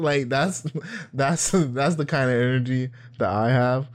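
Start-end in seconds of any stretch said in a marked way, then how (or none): none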